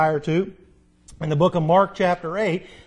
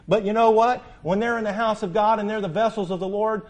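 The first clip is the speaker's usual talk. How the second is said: ordinary delivery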